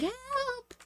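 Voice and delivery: tiny, very high, soft squeal; a faint far-away damsel's plea, squeaked; mock cry for help. Voicing Miss Anna's cry while falling.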